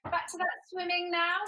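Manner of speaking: ordinary talk, nothing marked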